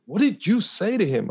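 voicing people's disbelief; amazed question. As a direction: onlookers asking in surprise. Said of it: "people could not believe it".

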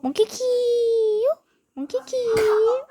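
squeaky slow questioning voice; high, slow, rising character voice; high puppet voice. Pretend. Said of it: "puppet character calling".

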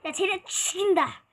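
ordinary delivery

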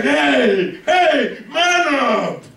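high-pitched comic scolding holler; punchy, hammed-up rallying call; calling out, retold. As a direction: shout across to frightened men to toughen up. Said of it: "He's like".

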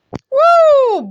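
high, loud excited whoop; loud high gamer whoop. Real, not acted. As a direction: cheer in delight. Cheering Bo arriving.

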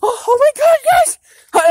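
high panicked yelps; panicked shrieking. Real, not acted. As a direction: shriek in sudden fright. Sees Bigfoot, screams.

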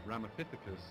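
ordinary talk, nothing marked

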